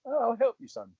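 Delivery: mild imitation of an approving mentor; Ian Bannon agreeing. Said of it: "he looked at me and he said".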